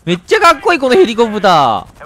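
loud, delighted exclamation; excited admiration. Real, not acted. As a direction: gush loudly over something cool. Admiring the helicopter in game.

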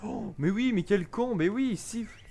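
excited. Real, not acted. Realises how to lure the guard.

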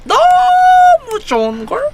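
high, slow cutesy squeal. Pretend. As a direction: stretch it out sweetly high. Aegyo peak.

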